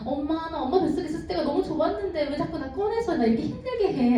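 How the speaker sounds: put-on high, pleading baby complaint; whiny baby voice. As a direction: speak as the newborn, whining up high. Baby voiced complaining about birth.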